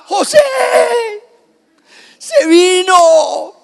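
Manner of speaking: high, loud, crying scream for help; Mary screaming. Retold or imitated. Mary in labour screaming to José, high and crying. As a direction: scream his name in labour pain.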